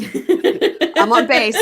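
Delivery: slow, laughing "I'm on bass"; mimicking him calling safe while laughing. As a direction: giggle through the game call. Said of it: "standing over by production van like".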